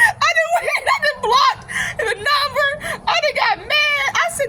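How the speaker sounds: high-pitched, helpless laughing through the words; squealing amused protest. Real, not acted. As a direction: talk through cracking up. Laughing as she recalls hanging up.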